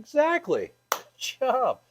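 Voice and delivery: bright, high-pitched praise for a student; excited praise. Real, not acted. Instructor praising a student's answer, excited and high.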